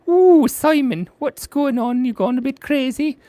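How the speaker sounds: high-pitched puppet voice mocking a slip; squeaky teasing voice. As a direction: tease him in a high squeaky character voice. Second voice teases him again.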